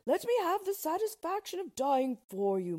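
high, loud noble plea; impassioned king. A narrator's reading. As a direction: offer your life ardently. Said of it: "said he".

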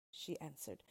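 usual speaking voice

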